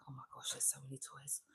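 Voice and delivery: fast hushed whisper to herself. Real, not acted. Alone in the toy room, whispering to herself. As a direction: breathe it out under her breath, quickly. Aside while fetching the fan.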